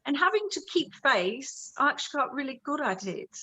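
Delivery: English accent. Shown as a demonstration. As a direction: keep up the English accent while explaining. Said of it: "talking like this".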